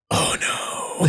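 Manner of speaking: breathless whispered dread. Retold or imitated. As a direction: whisper it in defeat. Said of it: "He's just like".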